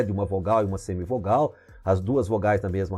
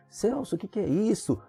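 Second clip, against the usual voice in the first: higher, softer student's voice; mock bewildered question, pitched up and quiet; puzzled student; 8 semitones higher, 5 dB quieter than usual. Student asking the teacher about a strange word, puzzled.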